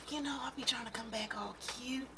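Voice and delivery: soft confiding whisper; whispered aside. Real, not acted. Vlogger confiding to viewers about looking cute, whispered. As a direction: let them in on a little secret.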